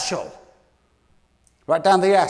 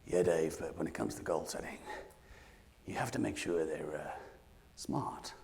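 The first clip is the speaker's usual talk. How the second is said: sceptical listener; 13 semitones lower, 17 dB quieter than usual. Retold or imitated. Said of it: "Somebody in the room will be thinking this thought".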